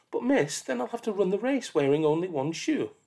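put-on pleading child. Child begs the teacher about the shoe, pleading. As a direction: whine like a worried kid.

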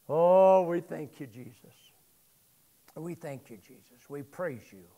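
hushed closing prayer trailing into a whisper. Acted.

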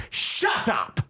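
high, yelled cut-off; acted angry shout. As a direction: snap out of it with a mock-furious yell. Acted interruption of self-loathing.

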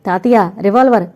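loud urgent call to grandpa; boy shouting to grandpa to catch revolver; shouting warning. A narrator's reading. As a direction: yell it across the room. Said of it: "గట్టిగా అరిచాడు మనోజ్".

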